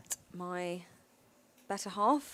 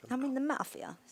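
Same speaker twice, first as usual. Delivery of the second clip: higher-pitched Jersey mobster parody; New Jersey accent; 5 semitones higher than usual. Acted. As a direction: play a mock New Jersey mafioso. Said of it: "Can you do a New Jersey accent".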